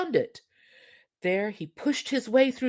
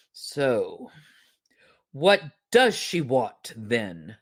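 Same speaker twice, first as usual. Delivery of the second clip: low voice of the magic fish; 6 semitones lower, 5 dB louder, slower than usual. Acted. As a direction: speak low and slow like the old fish. Fish speaks.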